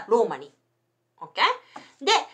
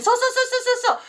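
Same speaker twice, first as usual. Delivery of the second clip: 1 excited agreement; 12 semitones higher, much faster than usual. Real, not acted. Sudden recognition, rapid agreement.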